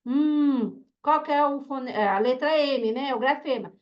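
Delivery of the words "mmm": M held as a long closed-lip hum; som do M. Shown as a demonstration. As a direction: sound the phoneme "hum", not the letter name. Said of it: "por exemplo, a letra M". (som prolongado do M, "hum")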